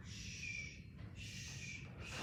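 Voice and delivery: high, soft shushing; librarian shushing. Acted. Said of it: "What did a librarian say?".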